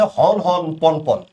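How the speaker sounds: "হন হন পন পন" drawn out slowly; poem words recited slowly for students; slow recital. Shown as a demonstration. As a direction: chant the sounds slowly. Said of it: "কবিতা আছে".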